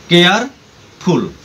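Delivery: "Careful" in two slow parts, pause before फुल; split into syllables. Shown as a demonstration. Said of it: "शब्दों को अलग अलग करना".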